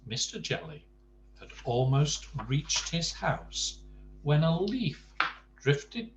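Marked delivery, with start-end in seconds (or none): none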